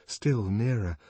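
ordinary delivery

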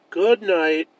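plain reading voice

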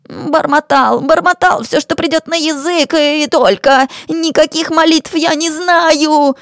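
high, whining confession; frightened wheedler making excuses; cowardly cornered rogue. Acted. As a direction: admit it pleadingly in a high voice.

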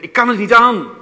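high, loud, desperate outcry of fear; frightened cry. Acted. A believer in the deepest darkness crying out, loud and afraid. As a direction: cry out that it is too much to bear. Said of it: "waar je zegt".

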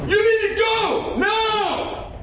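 all-out hammy shout of a warning; yelling at a ten. Acted. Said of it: "Right now you're at a ten".

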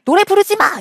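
put-on high, whiny toddler shout; imitating a cranky toddler telling her to stop; toddler protest. Sleepy toddler protesting the lullaby, high mock yell. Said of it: "갑자기 저한테".

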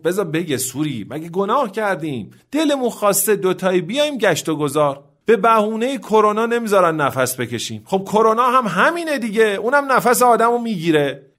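defiant protest, climbing in pitch; high-pitched, building indignant declaration. A narrator's reading. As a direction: speak up boldly, pitch rising as you go. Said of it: "آقای شادمان با صدای بلند گفت".

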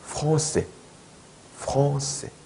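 프랑세 as "frong-se" with nasal "ong"; correct French pronunciation. Shown as a demonstration. Teacher modelling for learners, 프랑세 as French "frong-se". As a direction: say 프랑세 with a throaty r and rounded vowel. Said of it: "프랑세 하면 안 돼요".

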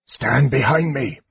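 hoarse, raspy whispered order. A narrator's reading. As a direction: rasp out a whispered command. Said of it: "ordered Raistlin in a hoarse whisper".

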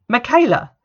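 put-on calling a fleeing girl's name, high and loud; shouted call. A narrator's reading. Teacher shouting after a running student, loud high call. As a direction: yell her name across the schoolyard.

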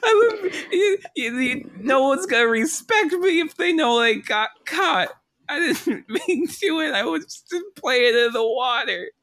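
put-on high, sobbing drunk plea; crying gingerbread man. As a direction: wail it high through laughing sobs.

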